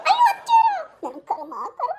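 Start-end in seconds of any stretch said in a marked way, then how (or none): 0.1-0.7 high, loud hammed-up wail; loud, high mock wail. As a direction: wail it like a melodrama. Dramatic wail to mother-in-law.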